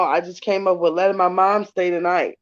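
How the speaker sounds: ordinary talk, nothing marked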